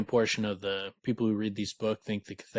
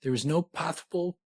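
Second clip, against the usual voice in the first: frustrated Cathay; 7 semitones higher than usual. Acted.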